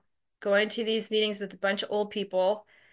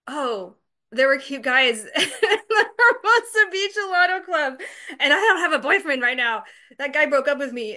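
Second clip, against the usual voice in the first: giggling through the words, higher; real laughter, a little higher; laughing; 5 semitones higher, 3 dB louder than usual. Real, not acted. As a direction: laugh at your own excuse. Laughing at her own motive.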